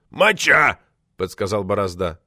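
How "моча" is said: heckle. A narrator's reading. Borozda heckles with a prompt.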